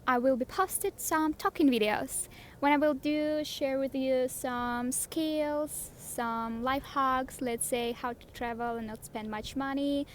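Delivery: ordinary delivery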